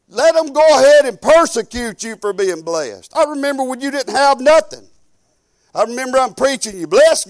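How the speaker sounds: loud, driving sermon delivery; preaching. Acted. Preacher urging the congregation, full-force preaching. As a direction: push the congregation with fire.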